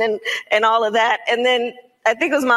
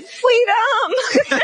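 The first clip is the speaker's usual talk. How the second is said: laughing punchline; 13 semitones higher than usual. Retold or imitated. Toddler's punchline answer.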